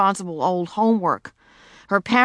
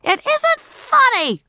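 put-on high, loud, slowed child's protest; very high loud indignant cry; shouted; 6 dB louder, slower than usual. A narrator's reading. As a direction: shout at laughing parents. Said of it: "shouted Ramona".

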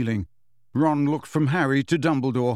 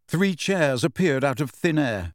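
ordinary delivery, nothing marked

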